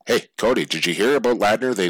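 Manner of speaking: usual speaking voice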